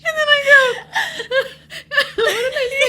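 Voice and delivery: very high, squeaky-with-laughter words; helpless laughing. Real, not acted. Asking friends what she said, in fits of laughter. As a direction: struggle to get it out while cracking up. Cracking up while telling the story.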